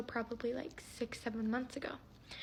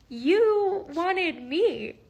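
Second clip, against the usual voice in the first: loud, drawn-out, higher-pitched indignation; indignant disbelief; 7 semitones higher, 12 dB louder, much slower than usual. Retold or imitated. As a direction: stretch each word out, loud and incredulous. Retelling her reply to being ghosted.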